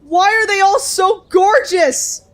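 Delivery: high, slow swooning; gushing. Real, not acted. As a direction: swoon over how gorgeous they are. Gushing over game art.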